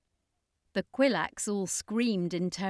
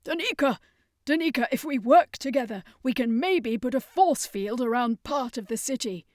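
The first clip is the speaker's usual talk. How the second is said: higher, louder urgent call; shouted plea; 5 semitones higher, 6 dB louder than usual. Acted. Circus master shouting to Danica, urgent shout. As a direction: call out to rally her. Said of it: "the circus master shouted".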